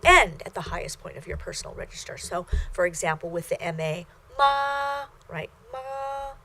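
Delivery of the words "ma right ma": MA held high and level; first tone. Shown as a demonstration. Teacher showing Mandarin learners first tone, high level MA. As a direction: sustain MA evenly at the top of your register. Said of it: "is a high level tone, stays the same level".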